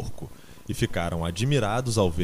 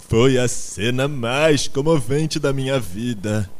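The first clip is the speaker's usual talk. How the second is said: slow, higher, loud emotional declamation; tearful orator; 4 semitones higher, 6 dB louder, slower than usual. A narrator's reading. Garganta recounts the deathbed to the animals, theatrically moved. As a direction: declaim your grief with heavy show. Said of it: "deixando rolar uma lágrima".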